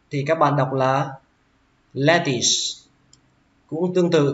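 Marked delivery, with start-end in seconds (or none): 1.9-2.5 lettuce with the first syllable up, the second falling; stress on first syllable. Shown as a demonstration. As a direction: say "lettuce" up then down. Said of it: "lên dòng âm đầu tiên và xuống dòng âm".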